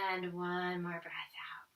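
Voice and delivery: soft whisper to breathe out; whispered breath cue. Real, not acted. Yoga teacher whispering a last exhale. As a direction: breathe it out in a hush.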